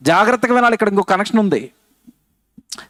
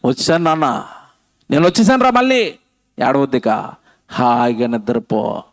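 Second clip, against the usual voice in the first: put-on soothing a baby; 8 semitones lower than usual. God soothing crying baby.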